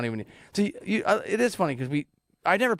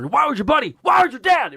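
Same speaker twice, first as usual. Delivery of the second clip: high, shouted film quote; movie-line impression; 10 semitones higher, 8 dB louder than usual. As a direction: belt out the quote, rising on the second question. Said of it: "here we go".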